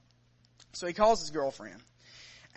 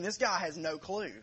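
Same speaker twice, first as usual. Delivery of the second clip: normal talking voice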